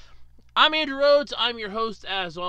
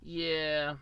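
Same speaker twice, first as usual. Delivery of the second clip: ordinary talk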